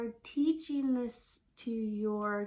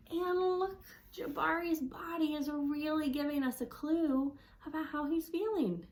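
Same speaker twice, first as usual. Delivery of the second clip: ordinary delivery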